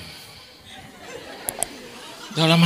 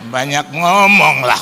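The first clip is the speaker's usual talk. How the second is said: mock fed-up outburst; 2 semitones higher, 4 dB louder, slower than usual. Fed-up employer gives up arguing with maid, mock yell. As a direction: draw it out loud, hammed-up annoyance. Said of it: "saya udah kesel".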